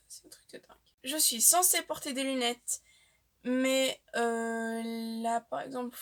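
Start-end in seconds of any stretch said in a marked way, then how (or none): 0.1-0.8 hushed and breathy, as if amazed at herself; confiding whisper. Real, not acted. Whispering to viewers about her baby memories, hushed.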